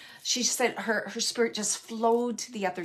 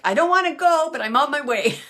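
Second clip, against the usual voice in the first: higher, louder mock protest, half-laughing; playful reluctance; 6 semitones higher, 7 dB louder than usual. Medium voicing a spirit reluctant to leave, playful loud protest. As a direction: whine it playfully, like a guest who won't leave.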